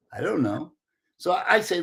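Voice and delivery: ordinary delivery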